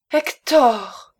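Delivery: appel crié, plus aigu et plus fort; cri désespéré. A narrator's reading. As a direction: crie son nom pour le retenir. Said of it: "Ce cri fit retourner le baron". (l'épouse crie le nom de son mari qui part)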